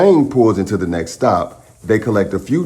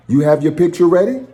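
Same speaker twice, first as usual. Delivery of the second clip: higher, quicker sister voice; sister's gentle question; 9 semitones higher, faster than usual. Acted. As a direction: coax him kindly, a bit quick. Sister gently asks Milo.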